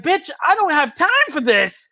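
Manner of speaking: high, loud impatient retort; acted fed-up outburst; mock exasperation. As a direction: cut off the monologue impatiently.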